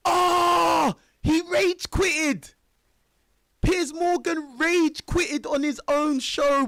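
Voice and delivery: real burst of excitement, loud and high; excited shout. Real, not acted. Reacting to Piers Morgan quitting his own show, excited real shout. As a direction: yell it out in disbelief, slowing on the last words.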